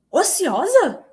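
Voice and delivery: high, incredulous question; imitating the boss's startled disbelief; shocked echo. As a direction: repeat the word as if it's scandalous. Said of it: "Aí ela fez uma cara assim".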